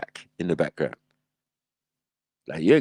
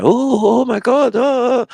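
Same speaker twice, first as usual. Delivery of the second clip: high mock amazed gasps; 7 dB louder, slower than usual. Mocking onlookers amazed at a foreigner, high squealing.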